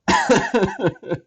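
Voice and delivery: sudden high loud laugh; loud burst of laughter. Real, not acted. Laughs at the irony.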